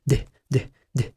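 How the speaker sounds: "د" said as a bare d with a sukun, no vowel after it; short d sound. Shown as a demonstration. Teacher models the letter D for the learner. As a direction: stop "د" dead with no vowel. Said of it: "مش دي على كسر".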